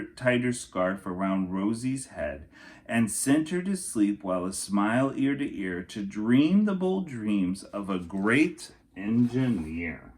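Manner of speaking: usual speaking voice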